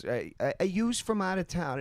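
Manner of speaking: high mock Philly accent. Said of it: "how the fuck's the Philly accent go".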